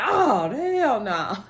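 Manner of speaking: slow drawn-out hell no; mock refusal. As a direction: stretch out the refusal. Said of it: "Billy looking like".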